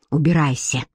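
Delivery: low, breathless order; out of breath. Acted. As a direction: gasp it out, winded. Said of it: "произнёс он, задыхаясь".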